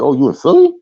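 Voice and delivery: high, loud, delighted-sounding question; excited surprise. Retold or imitated. Friend hearing he's in Philly, excited surprise. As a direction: burst out with imitated excited surprise. Retelling his friend's eager reaction.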